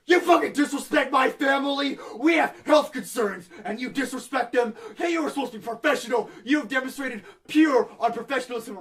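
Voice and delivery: imitated irate caller, loud and high; angry yelling. Retelling an angry customer on the phone, furious mock shouting. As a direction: yell it in outraged indignation. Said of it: "He starts fucking yelling at me".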